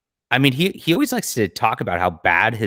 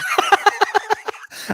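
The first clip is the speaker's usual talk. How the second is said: airy squeal of laughter; high breathy laugh. Real, not acted. Laughing at clip.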